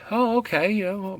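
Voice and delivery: high, loud, put-on "oh, okay"; awkward mock surprise. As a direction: react with exaggerated, awkward surprise. Acting out their awkward reply.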